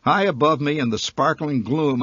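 plain reading voice

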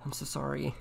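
high, small apologetic voice; pleading apology. Acted. Player to crying friend in the game, pleading apology.

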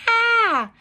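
very high, loud squeal of "Ah!"; letter A's yelp. Acted. The letter A answering Magic E, squealing loudly. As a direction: squeal the vowel out. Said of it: "And the letter A goes".

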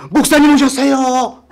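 lightly voiced meek young woman; polite sister-in-law. Sister-in-law greeting the pastor, meek and polite. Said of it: "딱 오는데".

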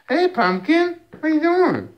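high squeaky pet voice. Real, not acted. Baby talk to her cat.

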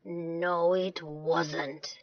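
low, soft grumble; deep, deadpan contradiction; grumpy. Acted. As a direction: answer sourly, unamused. Angry villagers answer laughing Sam.

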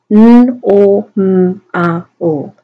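phonemes of normal, each isolated; "N, O, M, A, O" as speech sounds; sounding out. Shown as a demonstration. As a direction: hum and hold each separate sound. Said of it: "sound them out".

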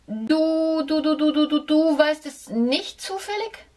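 hohe, nervöse Mäusestimme, schneller werdend; stotternde Maus. A narrator's reading. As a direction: stottere dich ängstlich durch wie eine Maus. Mouse stutters again. (ängstliche Maus stottert vor dem Hund)

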